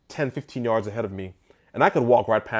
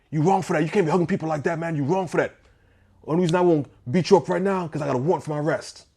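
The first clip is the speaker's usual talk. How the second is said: higher-pitched, agitated retold rant; worked-up accusation; 6 semitones higher, faster than usual. Angry groom confronting the man who hugged people, imitated worked-up protest. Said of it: "He's getting real emotional".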